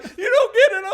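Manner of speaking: high, loud, laughing sob; breakdown. Retold or imitated. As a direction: crack apart on it. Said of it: "having my breakdown moment".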